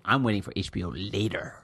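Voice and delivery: ordinary delivery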